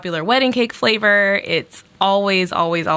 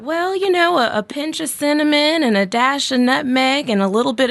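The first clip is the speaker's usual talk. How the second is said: lightly voiced grandma, higher; 8 semitones higher than usual. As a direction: vague old grandmother giving recipe. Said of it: "but I always got".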